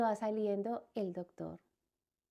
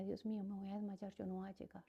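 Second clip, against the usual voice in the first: much softer, a mild retold panic; anxious inner voice; 13 dB quieter, faster than usual. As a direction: murmur it as if about to faint. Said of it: "Yo decía". (ansiedad contenida en voz baja)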